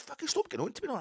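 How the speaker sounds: very high-pitched agitated imitation; retold angry retort. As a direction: play the man telling her to back off.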